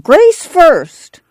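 high, insistent clamor; clamor for it as an impatient old woman; shrill insistence; clamoring grandma. A narrator's reading. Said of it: "Grandma clamored".

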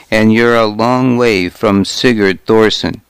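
ordinary delivery

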